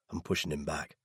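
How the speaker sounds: usual speaking voice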